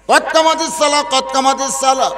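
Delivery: high, loud call to prayer; muezzin's call. Acted. Muezzin announcing the prayer is starting, loud chanted call. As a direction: call it out on a held high note. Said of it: "মুয়াজ্জিন বলতেছে".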